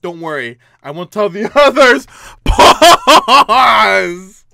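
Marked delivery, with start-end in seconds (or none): 2.4-4.4 sudden high loud yell of pause; shouted pause. Real, not acted. Gamer yelling pause at the creepy screen. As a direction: bark out pause in a panic. Panicked gamer halting the game.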